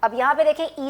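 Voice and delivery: normal talking voice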